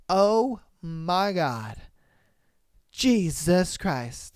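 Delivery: very slow, drawn-out wonder; mock slow-motion swoon; awestruck swoon. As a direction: stretch every word as if time stops. Retold girl swooning at first touch.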